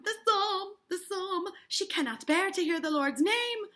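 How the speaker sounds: high, loud, dramatic alarm; horrified outcry. Acted. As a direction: cry it out as dreadful proof. Mrs. Putnam cries out, acted.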